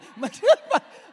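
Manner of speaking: loud high laugh breaking out; burst of laughter. Real, not acted. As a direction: crack up at your own joke. Laughs at naming Mike.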